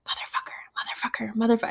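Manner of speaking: imitated sneaky whisper; quick, voiceless repeated whisper. Little boy kept cussing under his mask, quick whisper. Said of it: "like, whispering M F".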